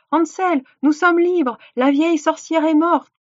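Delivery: high, excited cry of relief; joyful announcement. A narrator's reading. Gretel freeing Hansel from the stable, joyful. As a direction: call out the good news, voice lifted high.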